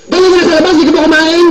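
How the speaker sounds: high scolding mom yelling at a late son; mock-angry mother voice. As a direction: scold him like an irritated mom. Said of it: "Galit talaga mama ko eh".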